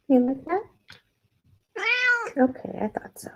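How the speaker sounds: high, sing-song pet voice; high cooing voice for a pet. Real, not acted. Talking to the cat.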